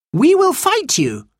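high, slow, bold challenge; defiant. A narrator's reading. Anthea threatens to fight Golden Eagle, defiant. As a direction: challenge the chief, spacing it out high.

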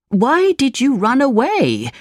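high, upset cry without much volume; demanding cry from an old woodcarver. A narrator's reading. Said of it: "Geppetto cried".

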